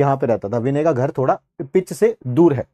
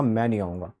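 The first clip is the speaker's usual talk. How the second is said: quiet refusal; 10 semitones lower, 10 dB quieter than usual. Retold or imitated. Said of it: "मैं कहा".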